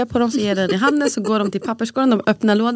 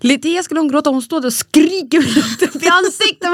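ordinary talk

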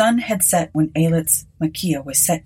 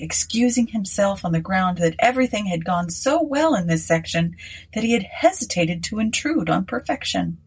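plain reading voice

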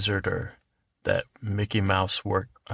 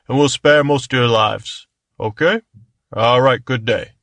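warm regal boom; 3 semitones higher, 7 dB louder than usual. Acted. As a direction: proclaim it like a smug king. King's ultimatum to fairies.

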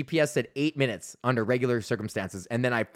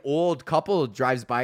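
ordinary talk, nothing marked

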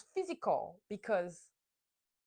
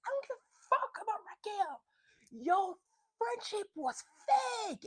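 high, strained yell without full volume; high-pitched mock outburst; retold yelling; 11 semitones higher than usual. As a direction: yell it indignantly, pitched way up, dragging out the last word. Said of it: "I'm not yelling too loud".

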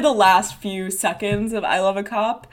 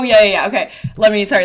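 ordinary delivery

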